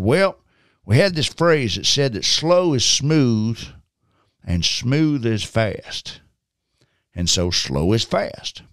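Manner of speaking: slow, stretched Southern drawl; imitated Southern veteran, very unhurried; thick Southern accent. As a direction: draw each phrase out like a Southerner. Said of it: "it's this very thick Southern accent".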